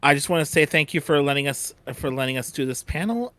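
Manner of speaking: normal talking voice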